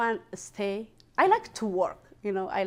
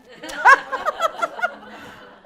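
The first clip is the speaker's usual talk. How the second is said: high laugh; 6 dB louder than usual. Real, not acted.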